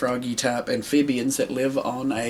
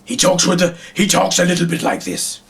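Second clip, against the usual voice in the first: raised head-alien puppet voice; Slagnar's alien voice; 5 semitones higher than usual. Shown as a demonstration. Puppeteer showing how his head alien talks, higher character voice. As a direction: pitch it up as the alien leader. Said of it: "Slagnar talks, he just talks like this".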